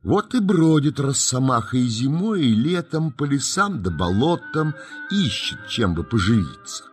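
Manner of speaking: ordinary delivery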